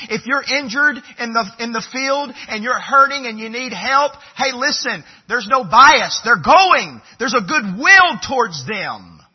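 loud, high, driving sermon voice; forceful preaching. Acted.

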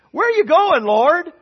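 high, loud squeaky question as Thomas; high mock question. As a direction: squeak it out in disbelief. Thomas voiced again, pitched up.

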